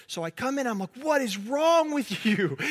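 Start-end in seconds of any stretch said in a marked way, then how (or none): 1.0-2.6 higher, slow, incredulous what is wrong with you; a tired dad at the end of his rope, drawn out; exasperated parent. Retold or imitated. To the screaming kid at bedtime, exasperated and slow. Dad bursting into kid's room.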